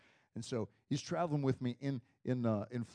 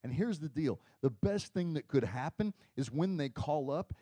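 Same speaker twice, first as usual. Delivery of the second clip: ordinary talk, nothing marked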